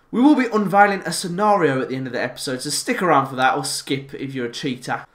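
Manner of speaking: normal talking voice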